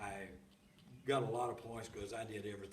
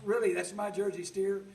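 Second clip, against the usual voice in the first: upset disbelief; 9 semitones higher, 9 dB louder than usual. Retold or imitated. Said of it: "Oh, I was so upset".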